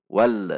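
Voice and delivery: "wal" with the l bounced into a short extra vowel; wrongly bounced lam. Shown as a demonstration. Teacher shows the forbidden reading, l bounced. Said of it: "tidak boleh dipantul".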